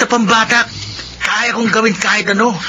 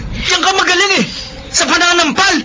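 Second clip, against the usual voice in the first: hurt retort; 8 semitones higher than usual. Acted. Brother slapped by sister.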